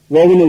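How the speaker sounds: ஓவன்னு with a long "oh" first; common mispronunciation. Retold or imitated. Teacher recalling many people's mistake, ஓவன்னு with long O. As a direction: say oven wrongly as "oh-ven". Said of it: "அது தப்பு".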